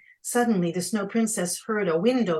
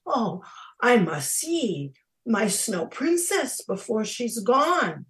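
raised, louder, urgent exclamation; anxious urgency; 6 semitones higher, 7 dB louder than usual. A narrator's reading. Prince Jack desperate to see the melting princess, anxious urgency. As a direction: exclaim it as if time is running out.

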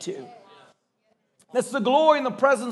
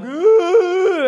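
high, loud sobbing ooh; mock crying wail; 8 semitones higher, 7 dB louder than usual. Retelling the soldier and the man hugging, a hammed-up sob. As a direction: wail it like two grown men crying.

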